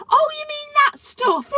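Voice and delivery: high, loud, slow wailing fake innocence; mock innocent thief. Mocking the caught shoplifter, high and loud playing innocent. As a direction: ham up a sing-song feigned surprise. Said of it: "Like this".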